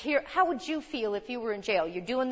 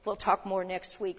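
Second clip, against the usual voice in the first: ordinary talk, nothing marked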